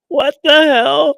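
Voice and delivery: very high, slow, loud shriek; shocked shriek. Retold or imitated. Vlogger voicing a stunned wrestler's shriek. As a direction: shriek it in disbelief. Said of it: "who's like".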